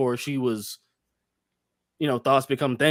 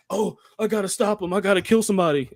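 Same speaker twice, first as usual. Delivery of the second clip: higher-pitched imitation of alarm; lightly hammed-up panic in a raised pitch; mock panicked realisation; 6 semitones higher than usual. Acting out the woman's alarm.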